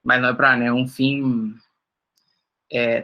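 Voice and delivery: ordinary talk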